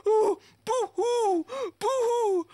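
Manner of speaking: hammed-up pretend crying; mock weeping. Cheesemaker in a play has no cheese, mock sobbing.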